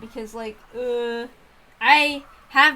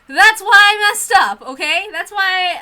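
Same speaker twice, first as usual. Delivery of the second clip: louder, higher and slowed, insisting she'll mess up; flustered outburst; 8 semitones higher, 11 dB louder than usual. Real, not acted. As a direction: blurt it out defensively, pressing each word. Flustered streamer excusing her mistakes.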